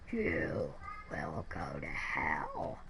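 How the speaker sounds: very deep, slow demon growl; demonic voice. Retold or imitated. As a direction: drag each word down from the pit of hell. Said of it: "in a demonic voice this time".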